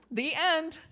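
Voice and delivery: high, bright-eyed mock finale; mock fairytale ending. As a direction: announce it like a storybook close.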